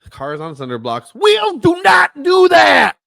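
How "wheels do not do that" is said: high, loud, slow deadpan; loud mock outrage. Friend mocking the cinder block gag, loud and slow. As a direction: spell it out loudly, slowly.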